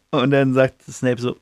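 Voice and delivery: usual speaking voice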